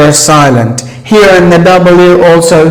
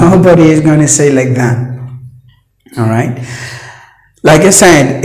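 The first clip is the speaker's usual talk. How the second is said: ordinary talk, nothing marked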